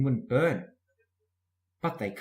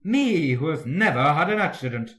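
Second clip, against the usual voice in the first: louder huffy engine voice; indignant; 4 semitones higher, 8 dB louder than usual. A narrator's reading. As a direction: puff up with offended pride. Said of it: "letting off steam".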